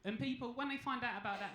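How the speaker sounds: normal talking voice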